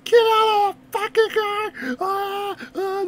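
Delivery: high, drawn-out mock scream; hammed-up panicked yell; freaking out. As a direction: imitate panicked passengers screaming, stretched and high. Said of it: "they're freaking out".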